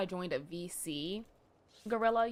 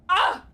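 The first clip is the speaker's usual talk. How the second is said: yelp; 9 semitones higher, 11 dB louder than usual. Real, not acted. Cat biting.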